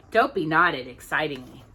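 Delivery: ordinary delivery, nothing marked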